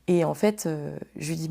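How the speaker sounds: normal talking voice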